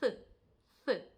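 tongue between the teeth, th; th with the tongue pushed out between the teeth; Spanish th sound. Shown as a demonstration. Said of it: "І в нас виходить".

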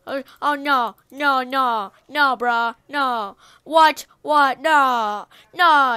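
repeated loud cries of disbelief; loud dismay. Real, not acted. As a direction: protest as it all goes wrong. Block opens something bad.

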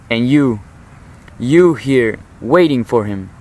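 emphatic quote, punching both YOU and WAITING; stress on YOU and WAITING. Acted. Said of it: "places emphasis on the word you and waiting".

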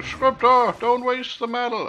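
high loud yell; shouting. Retold or imitated. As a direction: shout the warning across. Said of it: "Now you're shouting".

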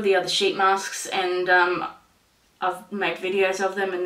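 speaking behind fingers; muffled through hand. Real, not acted. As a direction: hand covering mouth. Seen 0: Hand covers mouth over sheet mask; back again 6.2-7.6.

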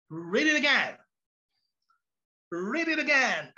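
mock-exasperated teacher's order; demanding teacher. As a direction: order the class back to work. Parodied teacher orders class to reread.

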